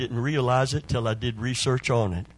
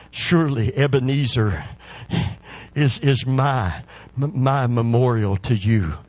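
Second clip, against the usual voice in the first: fervent public prayer; 5 dB louder than usual. Real, not acted. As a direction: pray aloud with rising force. Leads prayer for healing.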